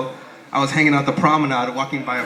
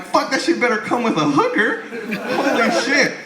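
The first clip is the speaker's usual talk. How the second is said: hammed-up shock at the price; mock outrage at the price; 9 semitones higher, slower than usual. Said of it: "I'm like".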